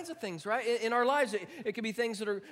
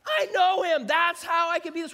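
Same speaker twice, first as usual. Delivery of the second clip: joyful shout; 8 semitones higher, 11 dB louder than usual. Shown as a demonstration. Preacher showing congregation a joyful answer, shouted high. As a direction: proclaim it with bursting joy. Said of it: "you can say".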